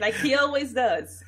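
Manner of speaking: loud, high, laughing interjection. Real, not acted. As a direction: chime in loudly through a real laugh. Laughing along with the co-host.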